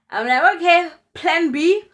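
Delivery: high, loud burst of resolve; sudden loud high "Okay, plan B"; excited plan. Retold or imitated. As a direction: announce plan B loudly and high.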